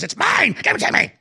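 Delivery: snatch at the ring, greedy and shrill-pitched; ring-crazed Bilbo. Retold or imitated. Host imitating Bilbo grabbing for the ring, high and grasping. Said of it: "when Bilbo Baggins reaches for the ring".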